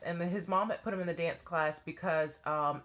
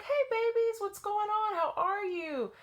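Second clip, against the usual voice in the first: put-on high, cheery call to kids; bright greeting. Teacher greeting the playground kids, high and bright. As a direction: greet the children with delight.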